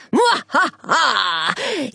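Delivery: slow evil cackle; villain's drawn-out cackle; wild unhinged ranting. Acted. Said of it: "the evil fairy".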